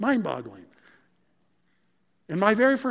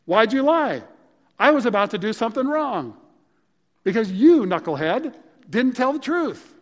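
higher, louder scolding; upset Pharaoh; 5 semitones higher, 6 dB louder than usual. Retold or imitated. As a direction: tell off the knucklehead who lied, pitched up and loud. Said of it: "Pharaoh's totally upset".

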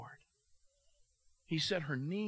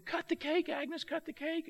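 high-pitched eager chant of a crowd; crowd urging; 13 semitones higher than usual. Retold or imitated. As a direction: egg her on, voice pitched way up. Whole diner urging her on.

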